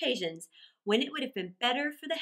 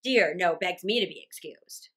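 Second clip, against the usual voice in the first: loud, emphatic sarcasm; heavy mocking stress, easing off at the end; dangerously sarcastic; 8 dB louder than usual. A narrator's reading. Father rants about his tie, loud biting sarcasm. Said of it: "He became dangerously sarcastic".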